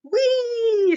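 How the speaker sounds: high, loud whee; Froggy swinging high, whooping; gleeful whee. Acted. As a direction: squeal with joy on the swing. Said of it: "sang Froggy".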